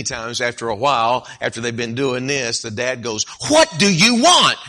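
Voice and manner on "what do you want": loud, much higher snap; irritated dad. Acted. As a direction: snap at the nagging kid. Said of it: "the dad shouldn't respond like that".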